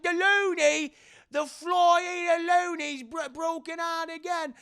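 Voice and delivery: high, loud Cockney orderly yelling; Cockney accent. Retold or imitated. Cockney orderly yells that the loony has broken out again. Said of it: "very Cockney accented".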